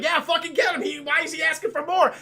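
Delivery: high, loud jeering at Oliver; kids jeering. Retold or imitated. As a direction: cheer on the beating like mean orphans.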